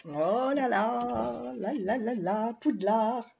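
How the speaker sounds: slow, drawn-out "oh là là" of wonder; awed. Real, not acted. Vlogger marvelling at the Hogwarts design for viewers, slow and awed. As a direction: savour each word in amazement.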